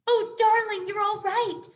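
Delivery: high, loud tearful relief; overjoyed relieved mother; relieved cry. A narrator's reading. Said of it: "cried the mother hare".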